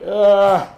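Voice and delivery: mock groan at the wound; retold groan. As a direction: act out a loud "ugh" of dismay.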